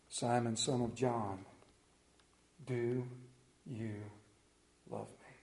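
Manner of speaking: Jesus asking Peter a third time, slow and hushed; gentle third asking. Acted. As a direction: lay each word down quietly. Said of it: "Peter was hurt because Jesus asked".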